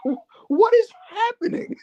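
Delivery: high, slow incredulous question; baffled disbelief. Retold or imitated. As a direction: draw it out high, utterly bewildered. Retells own bafflement at the quarrel.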